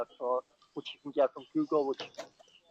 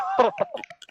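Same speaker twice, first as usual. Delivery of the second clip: high burst of laughter; 3 dB louder than usual. Real, not acted. Laughing before funny story.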